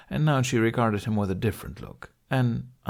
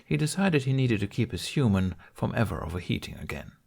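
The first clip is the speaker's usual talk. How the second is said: ordinary delivery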